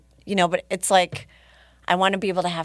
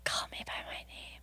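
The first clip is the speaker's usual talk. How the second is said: whisper; 17 dB quieter than usual. Retold or imitated. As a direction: ghostly whisper. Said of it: "It's like a whisper".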